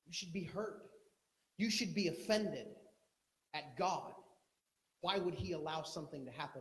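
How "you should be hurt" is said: ordinary talk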